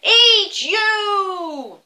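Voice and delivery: high, loud, very slow troll threat; laughing monster threat; menacing troll. Acted. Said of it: "said the Troll".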